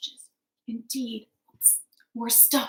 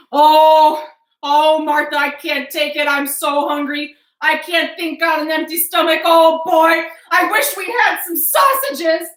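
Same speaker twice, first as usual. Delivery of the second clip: whiny hungry husband; 6 semitones higher, 15 dB louder than usual. Acted.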